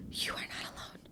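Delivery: a soft whispered reassurance; tender whisper. Real, not acted. Whispering comfort to listeners who feel alone, tender. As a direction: whisper it gently to someone hurting.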